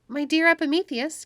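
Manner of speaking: high, loud eager call; cried out. A narrator's reading. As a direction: call across the room to him. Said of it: "cried Pandora".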